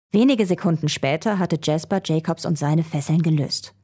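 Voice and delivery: plain reading voice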